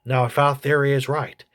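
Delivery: ordinary delivery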